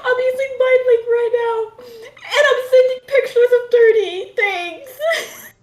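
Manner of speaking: high, laughing, half-shouted explanation; laughing confession. Acted. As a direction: spill it through helpless laughter. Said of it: "I'm gonna be like".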